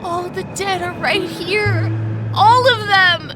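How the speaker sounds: frightened man on the edge of hysterics; rattled and fearful, breaking into nervous sobbing laughter; spiralling into panic; panicked. Acted. Panicked man sees unseen monsters.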